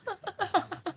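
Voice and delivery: squeaky high laugh; high giggle. Real, not acted. Laugh after picky remark.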